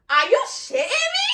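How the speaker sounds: high, drawn-out squeal of disbelief; outraged disbelief. Acted. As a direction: shriek it like you can't believe it. Outraged disbelief at cheating partner.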